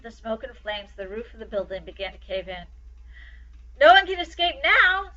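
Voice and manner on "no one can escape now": higher, loud alarmed cry from the crowd. A narrator's reading. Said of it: "someone cried".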